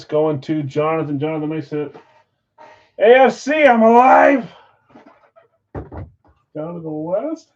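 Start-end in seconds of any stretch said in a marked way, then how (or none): 3.0-4.5 loud triumphant cry; excited shout. Real, not acted. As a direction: yell it out in pure joy. Excited shout after a hit.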